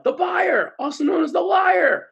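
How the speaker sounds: high, loud shouted answer from the crowd; audience yelling. Retold or imitated. Crowd yells the answer at the speaker, loud shout. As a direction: shout it out like a whole room. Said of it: "And they yell".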